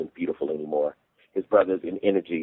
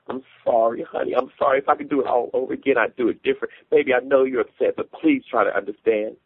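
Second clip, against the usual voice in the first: higher-pitched, urgent pleading; pleading apology; 7 semitones higher than usual. A narrator's reading. Wife begging her upset husband to understand, pleading. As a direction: beg forgiveness in a raised voice.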